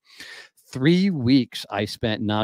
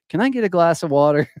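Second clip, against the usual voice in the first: mock request, higher and louder; high-pitched plea; 8 semitones higher, 5 dB louder than usual. Salesman repeating his pitch at every house, high mock plea. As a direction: play up the routine in a raised voice.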